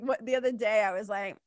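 normal talking voice

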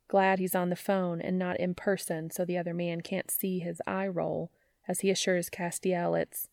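ordinary delivery, nothing marked

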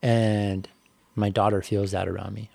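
ordinary talk, nothing marked

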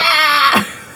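hammed-up nasal Fran Drescher yelp. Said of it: "that was a really bad Fran Drescher".

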